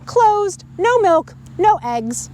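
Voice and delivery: put-on lively read-aloud to children; storybook reading. A narrator's reading. Reader telling children the farm story, lively read-aloud. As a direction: tell it like a bedtime story. Reads picture book to kids.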